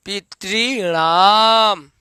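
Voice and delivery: पितृणाम spelled out very slowly with a retroflex ण; slow careful pronunciation. Shown as a demonstration. Teacher shows students the ण in पितृणाम slowly. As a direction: stretch out पितृणाम syllable by syllable. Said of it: "जैसे यहाँ हुआ है पितृणाम".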